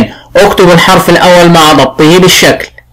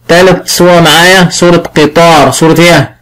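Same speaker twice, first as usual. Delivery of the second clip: usual speaking voice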